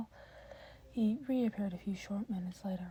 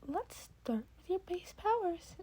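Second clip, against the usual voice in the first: higher, whispered mentor suggestion; Nezu's voice; 9 semitones higher than usual. Acted. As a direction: suggest it lightly, pitched up. Nezu voiced by reader.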